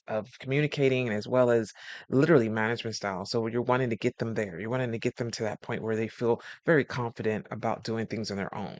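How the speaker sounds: normal talking voice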